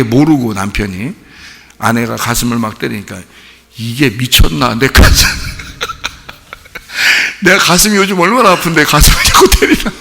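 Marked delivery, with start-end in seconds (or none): 3.8-9.9 high, loud, hammed-up protest; mock indignant husband. Husband whose aching chest is being hit, mock indignant outburst. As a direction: protest loudly in comic outrage.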